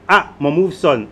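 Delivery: ordinary delivery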